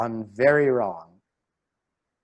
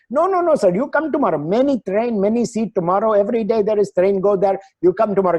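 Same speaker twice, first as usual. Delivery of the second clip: lightly imitated Indian English ticket clerk, higher; a hint of an Indian clerk's accent, insistent and a bit higher; 7 semitones higher, 4 dB louder, faster than usual.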